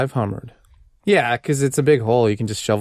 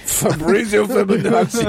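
slow, high grand full-name reveal; purring latin lover; 6 semitones higher than usual. Pretend. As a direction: announce the name with a flourish.

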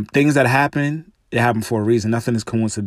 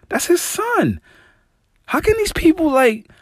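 outraged. Real, not acted. Host defending LeBron to his viewers, outraged. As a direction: voice leaps up high in disbelief.

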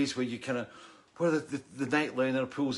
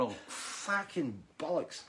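exasperated curse; 5 semitones higher, 6 dB quieter, slower than usual. Retold or imitated. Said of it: "I'm going".

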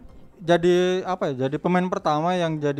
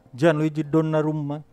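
put-on Italian accent. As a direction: say the name like a proud Italian. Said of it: "Mesti gitu ngomongnya". (logat Italia)

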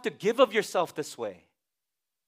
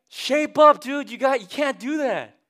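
high, louder push; loud mock scold; 7 semitones higher, 7 dB louder than usual. Counselor tells Billy to shape up, loudly. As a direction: snap him into shape.

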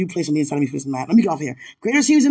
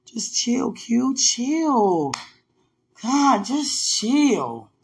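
slow self-calming; 5 semitones higher, much slower than usual. Real, not acted.

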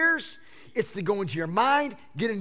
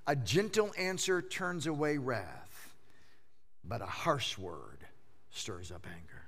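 soft scripture reading; 6 semitones lower, 8 dB quieter than usual. Real, not acted. Reads proverb softly, emphatically.

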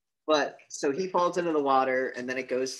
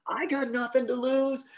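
very high, raised cry; mock desperate defiance; 13 semitones higher, 4 dB louder than usual. Gunman refuses to back down, high hammed-up defiance. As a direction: imitate a cornered man shouting back.

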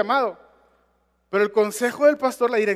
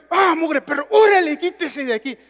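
shooing a dog; 5 semitones higher, 5 dB louder than usual. Retold or imitated.

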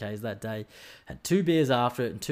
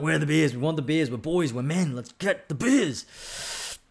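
rowdy beer demand; 5 semitones higher, 3 dB louder, slower than usual. Retold or imitated. Demanding beers from the pro shop.

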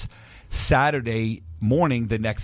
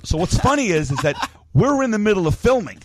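ordinary talk, nothing marked

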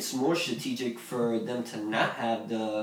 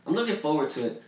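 ordinary delivery

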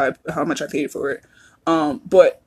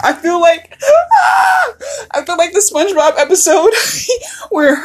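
real laughter breaking through, higher and cracking; words squeezed out through a fit of giggles; laughing hard; 11 semitones higher than usual. Real, not acted. Podcaster cracking up over her card, laughing.

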